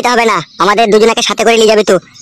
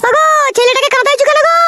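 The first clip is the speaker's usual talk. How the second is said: much higher, shrill-pitched wife voice; scolding mother. Acted. Wife scolding husband over crying son, high-pitched.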